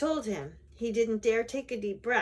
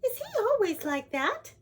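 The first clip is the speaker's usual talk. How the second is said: high, loud, astonished question; taken-aback princess; shocked cry; 12 semitones higher, 5 dB louder than usual. A narrator's reading. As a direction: ask it in high-voiced dismay.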